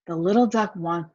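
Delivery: ordinary delivery, nothing marked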